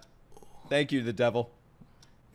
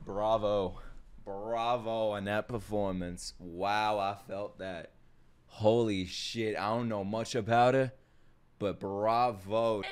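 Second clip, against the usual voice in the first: slow, hushed, awestruck praise; quietly stunned by the acting; awed and quiet; 7 semitones lower, 9 dB quieter, slower than usual. Real, not acted. Awed by performance.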